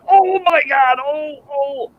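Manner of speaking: very high, loud acted scream. Lone Ranger bitten while relieving himself, high pained screaming. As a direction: scream out in sudden pain. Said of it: "all of a sudden he's screaming".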